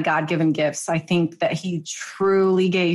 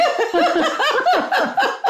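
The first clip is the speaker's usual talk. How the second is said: a real, bright, high laugh; high burst of laughter. Real, not acted. Guest laughing at the host's gushing praise, a high real laugh.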